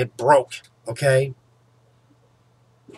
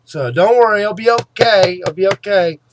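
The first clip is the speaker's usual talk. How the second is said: mocking the dentist; 5 semitones higher, 7 dB louder than usual. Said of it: "Then after it broke".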